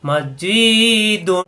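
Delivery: মসজিদুন with the জি drawn long and slow; stretched vowel. Shown as a demonstration. Teacher showing students the long জি in মসজিদুন. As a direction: lengthen the জি in মসজিদুন by one alif. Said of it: "জিকে লম্বা করতে হবে".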